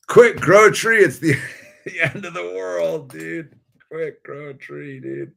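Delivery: a sarcastic mock alarm; mock panic. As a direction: play at doomsday urgency, laughing. Mocking doomsday panic to himself.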